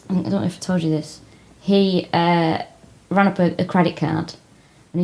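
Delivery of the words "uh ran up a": normal talking voice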